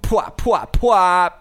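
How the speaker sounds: high, loud popping mouth sound. Acted. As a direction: pop it out like a DJ drop. DJ sound effect drop.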